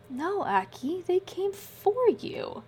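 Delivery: high, slow and gentle; tender. A narrator's reading. Ileana gently telling Aki the truth, tender and slow.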